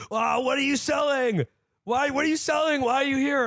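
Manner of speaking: high, mocking teen shout from a car; rowdy teen yelling. Teen leaning out of a car, taunting the canvasser, high mock shout. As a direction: yell it out the window like a bratty teen. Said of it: "leans out of the window and goes".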